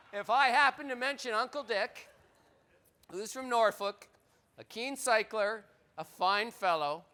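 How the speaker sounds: mock Norfolk accent, slowing on fine fellow; bad Norfolk accent. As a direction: play at a Norfolk yokel accent and miss. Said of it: "that's a terrible accent".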